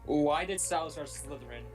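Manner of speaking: normal talking voice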